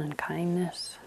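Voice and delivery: soft whispered meditation cue. Acted. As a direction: breathe the words gently.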